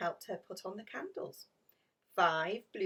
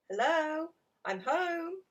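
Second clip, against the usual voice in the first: higher, louder cheerful call; 9 semitones higher, 5 dB louder, slower than usual. Acted. Bob arriving home to a dark house, calling out. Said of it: "he called".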